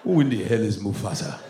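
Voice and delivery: low, baffled Nigerian father; father's Nigerian accent. Retold or imitated. Father answering a confused caller, low Nigerian accent. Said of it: "My father would pick up the phone".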